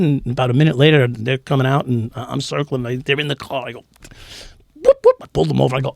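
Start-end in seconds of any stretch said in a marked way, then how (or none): 4.7-5.2 high imitated police-siren whoop. Pulling his buddies' car over, a high siren imitation. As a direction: yelp it like a cop car siren.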